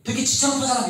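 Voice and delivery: hammed-up student jab; mock complaint about teacher. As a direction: point at the teacher and gripe. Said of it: "이런 뜻이 아니지".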